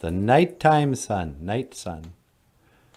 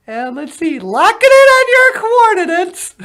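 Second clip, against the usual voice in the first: very high, loud squeaky alien; 9 dB louder than usual. Acted. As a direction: greet him like a tiny cartoon alien.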